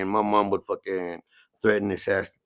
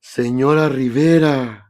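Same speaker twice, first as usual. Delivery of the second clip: slow, higher, suddenly polite; sheepish greeting; 4 semitones higher, 6 dB louder, slower than usual. Retold or imitated. Principal greeting the angry mother, slow sheepish politeness. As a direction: turn meek and slow before the mother. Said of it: "he just turned around like".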